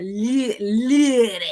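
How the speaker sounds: "lady" practiced with a dropped New Orleans vowel; New Orleans pronunciation. Retold or imitated. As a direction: imitate the accent on "lady", amused. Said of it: "how the fuck he said".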